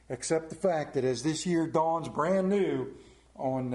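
ordinary talk

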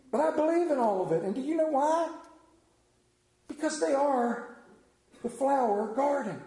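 ordinary delivery

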